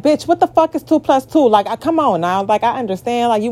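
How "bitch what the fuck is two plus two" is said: exasperated retort. Retold or imitated. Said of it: "And it's like".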